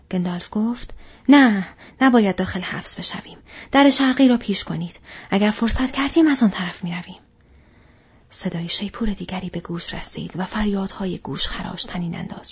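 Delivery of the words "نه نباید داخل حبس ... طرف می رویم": louder, high and insistent; forceful command. Acted. Gandalf overrules Aragorn and orders the east door opened, forceful. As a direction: overrule them with authority.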